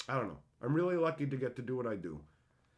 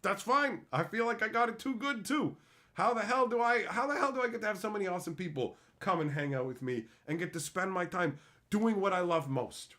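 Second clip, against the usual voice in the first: excited higher voice rushing with disbelief; giddy disbelief; 4 semitones higher than usual. Retold or imitated. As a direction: gush like you won the lottery. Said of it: "And I'm like".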